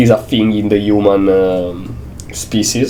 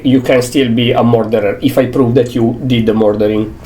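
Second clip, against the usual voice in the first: normal talking voice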